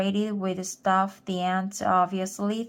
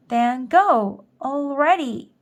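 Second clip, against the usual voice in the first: loud, higher, impatient shout; 5 semitones higher, 6 dB louder than usual. A narrator's reading. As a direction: shove them out the door with your voice. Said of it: "she shouted".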